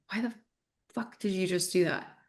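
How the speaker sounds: quick irritated snap, held back in the voice; a mom's default snap at her child, mildly voiced; snapping at the kid. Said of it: "Instead of the default voice going".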